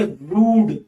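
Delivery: रूड said with a long ऊ vowel; teacher demo of रूड with a long ऊ; long oo sound. Shown as a demonstration. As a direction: stretch the ऊ in रूड. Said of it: "तो ये sound कौन देता है".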